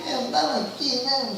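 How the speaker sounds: put-on high, soft caught-child voice; child caught by mom, high and sheepish; lame excuse. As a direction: squeak out a guilty excuse.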